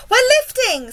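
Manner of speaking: very high, loud excited cry; shout. A narrator's reading. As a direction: shout the news at a high pitch. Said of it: "somebody shouted".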